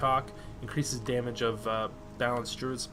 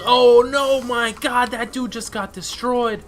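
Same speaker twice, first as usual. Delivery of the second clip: loud shocked laughing cry; shocked outburst; 8 semitones higher, 9 dB louder, slower than usual. Real, not acted. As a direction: blurt it out in shock. Reacting to enemy death.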